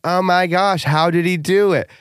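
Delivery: high, excited gushing; gushing girlfriend voice. Retold or imitated. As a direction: gush like a thrilled friend. Gushing friends quoted.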